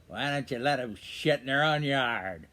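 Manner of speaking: mock snarl of an old woman; old lady's snarl. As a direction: snarl it like a cross old lady. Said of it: "she snarls at me and she says".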